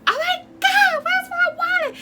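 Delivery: very high, loud panicked shriek. Retold or imitated. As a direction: shriek it like the wallet just vanished. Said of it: "I was like".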